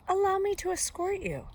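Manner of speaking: high, loud, gallant offer; ingratiating wolf. A narrator's reading. Wolf offering the girl company, ingratiating. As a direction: swoop up high with false courtesy.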